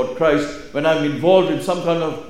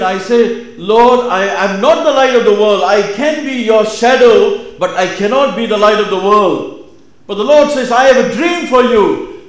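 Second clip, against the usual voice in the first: loud, higher-pitched preaching at full force; 9 semitones higher, 11 dB louder than usual. Acted. Preacher declaring God's dream for you, impassioned preaching. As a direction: proclaim it to the congregation with rising fervour.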